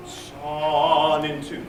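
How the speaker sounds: "Son into" slowly, vowel of "Son" held unchanged; steady vowel. Shown as a demonstration. Director shows singers to keep the vowel while growing. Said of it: "Don't let the vowel change. Just let it get louder".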